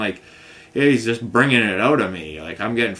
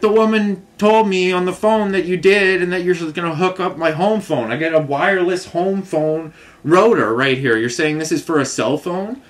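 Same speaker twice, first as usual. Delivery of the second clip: louder, higher, fed-up retelling; upset customer arguing with the phone company, raised and higher; exasperated complaint; 6 semitones higher, 4 dB louder than usual. Retold or imitated. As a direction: vent at the company rep, pitch climbing.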